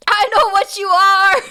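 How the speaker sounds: high, loud melodramatic cry; dramatic accusation. Acted. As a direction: shout it like a shocked film heroine. Shouted quote, dramatic acting.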